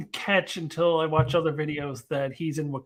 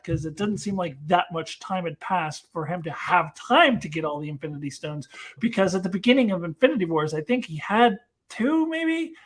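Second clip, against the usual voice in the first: ordinary talk, nothing marked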